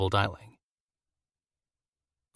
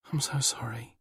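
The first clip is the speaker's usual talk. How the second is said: breathy whisper, barely voiced; whispered apology; 3 semitones higher, 6 dB quieter than usual. A narrator's reading. Alone in labour, whispering sorry to her absent mate. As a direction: whisper it like a secret confession.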